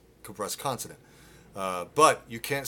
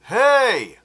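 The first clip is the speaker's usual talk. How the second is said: short loud narrow grunt; narrow grunt shout; 13 semitones higher, 15 dB louder than usual. Shown as a demonstration. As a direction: punch a tight hey. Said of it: "This is why people do this".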